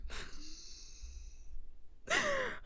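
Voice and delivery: a real high squeaky giggle fit; high breathless laugh. Real, not acted. Laughing at her ex's betrayal, a high breathless giggle. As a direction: crack up at the memory in a high wheeze.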